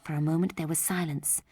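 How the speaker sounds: plain reading voice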